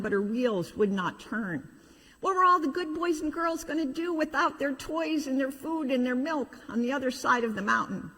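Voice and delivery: usual speaking voice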